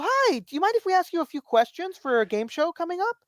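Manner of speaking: high-pitched mall pollster pitch; acted high-voiced questionnaire approach; imitated survey taker. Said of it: "and they'd go".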